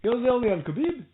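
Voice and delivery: high-pitched mock Irish, sounding almost Scottish; hammed-up Irish trainer impression; Irish accent. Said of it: "He sounds Scottish".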